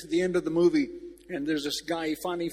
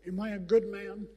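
put-on higher, louder plea of an old man; pleading old man; 5 semitones higher, 3 dB louder than usual. Old veteran at the grave asking his wife, higher plea. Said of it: "he looks at his wife, and he said".

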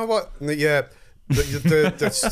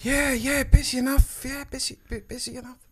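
London hangman voice; 9 semitones higher than usual. Acted. Hangman answering in role.

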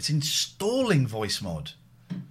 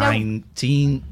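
slow drawn-out robot voice; 8 dB louder, much slower than usual. Shown as a demonstration. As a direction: stretch nineteen out slowly and loud. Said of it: "trying to do the voice yourself".

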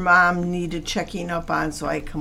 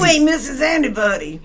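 raised, higher-pitched mock yell; retold yelling; 6 semitones higher, 6 dB louder than usual. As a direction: yell the put-down as a jeer. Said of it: "she's yelling at her".

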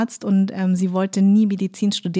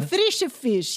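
Italian waiter; 4 semitones higher than usual. Retold or imitated. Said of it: "weil Italiener immer".

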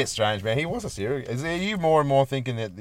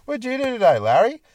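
higher, louder, cheery parental question; imitated mum; 12 semitones higher, 7 dB louder than usual. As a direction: lightly play a mum greeting her son. Voicing Larry's mum asking about his day.

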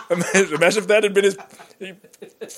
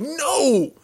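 sudden higher cry of no; mock outcry; 10 semitones higher than usual. Friend voicing a teacher's indignant high no. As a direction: yelp it like your plan just died. Teacher protesting her idea ruined.

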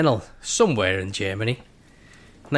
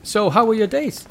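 put-on high, loud wandering German accent; comic German accent, pitched up; loose German accent; 10 semitones higher, 5 dB louder than usual. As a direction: chirp it in a badly faked German accent. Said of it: "No idea where I'm going with this accent".

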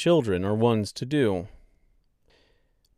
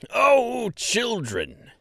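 put-on high hearty call to the children; a merry fat man calling out; jovial mayor; 12 semitones higher, much slower than usual. Mayor shoos playing children, jovial call. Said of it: "A loud, jovial voice boomed".

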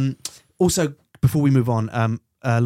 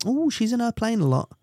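high-pitched imitation of complainers; mock tutting; 10 semitones higher than usual. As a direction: mimic a scandalised gossip. Mimics complaining public.

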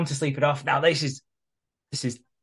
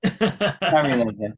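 laughing; 4 dB louder, slower than usual. Real, not acted. Echoing co-host, laughing.

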